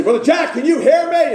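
high, loud shout across the church; loud call to Brother Jack. Retold or imitated. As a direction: call out so the deaf man at the back hears. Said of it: "and I'll say".

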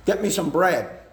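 loud, curt barked order; a gruff husband barking an order; barked command. Retold or imitated. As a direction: bark it like a bossy husband.

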